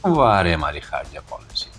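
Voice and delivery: ordinary delivery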